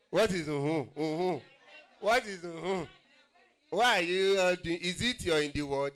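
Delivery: high, loud playful banter; excited teasing. Real, not acted. MC teasing the crowd back and forth, high and loud. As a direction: tease the crowd, laughing through it.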